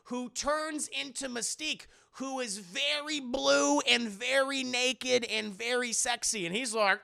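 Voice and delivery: usual speaking voice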